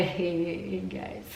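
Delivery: normal talking voice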